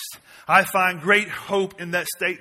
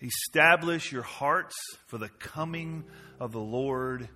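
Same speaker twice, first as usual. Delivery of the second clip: much softer, lower closing read; soft scripture reading; 7 semitones lower, 10 dB quieter than usual. Acted. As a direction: read it gently, letting it settle. Reading scripture softly to close.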